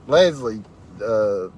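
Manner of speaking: ordinary talk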